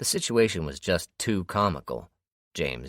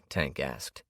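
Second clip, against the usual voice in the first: plain reading voice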